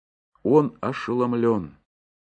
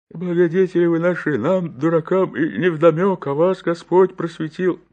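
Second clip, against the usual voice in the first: deacon's pleading voice; 6 semitones higher than usual. Acted.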